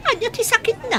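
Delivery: high, sudden alarmed question; jolted by the bad news. Mother learning her son is ill, high and alarmed.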